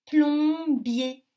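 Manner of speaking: PLOMBIER loud and high, plom held long; drawn-out syllables. Shown as a demonstration. Teacher gives children a harder word, PLOMBIER stretched out loudly. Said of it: "un peu plus difficile".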